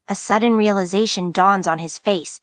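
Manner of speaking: ordinary delivery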